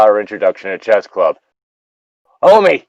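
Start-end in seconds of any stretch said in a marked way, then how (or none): 2.4-2.8 a loud, high call across the trail. Retold or imitated. Sam hails John with a loud, high, cheerful call. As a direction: hail a buddy with big cheer.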